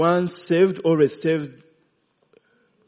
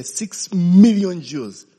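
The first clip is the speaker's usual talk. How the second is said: preaching emphasis; 5 semitones higher, 9 dB louder, slower than usual. Real, not acted.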